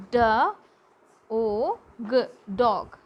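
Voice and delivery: "d, o, g" as isolated sounds, then "dog" whole; sounding out. Shown as a demonstration. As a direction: sound out "dog" piece by piece for learners. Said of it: "Speak the word aloud".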